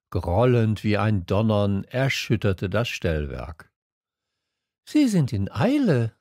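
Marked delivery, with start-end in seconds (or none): 4.9-6.1 put-on high young observer; Little Prince. A narrator's reading. Prince talks with the switchman about trains, high voice. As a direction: remark it in a high voice. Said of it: "sagte der kleine Prinz".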